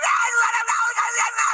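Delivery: very high, all-out acted cry; imitated beggar at full yell; shouting even harder. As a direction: scream out desperately over the crowd. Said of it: "Dia teriak lebih keras".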